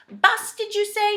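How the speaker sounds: high, loud, slow question; shouting back. A narrator's reading. Saucepan man mishears "us", shouting back. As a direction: call back, puzzled. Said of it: "shouted back the saucepan man".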